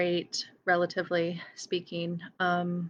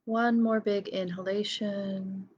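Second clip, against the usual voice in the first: guided meditation. Real, not acted. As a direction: lead each breath gently, leaving silence between phrases. Leads a breathing meditation.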